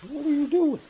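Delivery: very high puzzled question; puzzled at the dog; high dog-talk voice. Real, not acted. Talking to dog.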